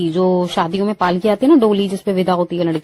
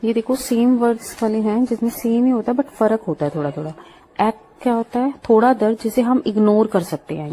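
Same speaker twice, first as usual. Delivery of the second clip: usual speaking voice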